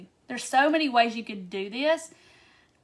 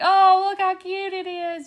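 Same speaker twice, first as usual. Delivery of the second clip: high, loud, slow squeal of delight; gushing over cute gnome; 9 semitones higher, 9 dB louder than usual. Retold or imitated. Crafter gushing over a friend's handmade gnome, high delighted squeal. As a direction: coo over it like a baby animal. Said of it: "I'm like".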